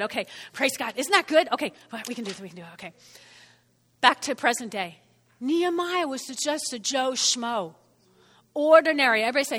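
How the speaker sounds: usual speaking voice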